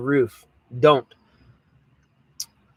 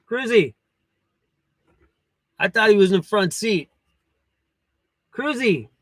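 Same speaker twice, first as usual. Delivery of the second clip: calling the dog; 4 semitones higher than usual. Real, not acted.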